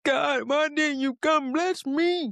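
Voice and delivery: high, whiny plea to God; whiny complaint. Retold or imitated. Preacher mimicking a bypassed believer whining to God. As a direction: whine it like a left-behind believer.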